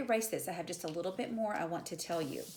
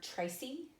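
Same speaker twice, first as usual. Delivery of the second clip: TRA-cy, accent on the first syllable of Tracy; Tracy stressed on "Tra", ending on a rise; trochaic stress; 7 semitones higher than usual. Shown as a demonstration. Teacher giving a trochee example, Tracy accented on "Tra". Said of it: "where you accent the first".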